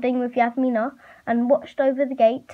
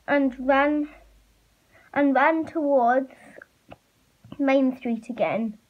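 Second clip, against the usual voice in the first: ordinary delivery, nothing marked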